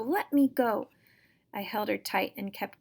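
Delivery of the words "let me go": loud, higher "Let me go!" repeated; yelling again. Acted. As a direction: keep yelling "Let me go!" as you fight. Sister thrashes in his grip.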